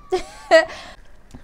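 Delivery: breathy burst of laughter. Real, not acted. Recalling his compliment on her voice, laughing. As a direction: laugh at your own retold flirting.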